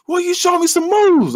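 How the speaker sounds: very high, slow call; mock shout over music. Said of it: "the music's too loud".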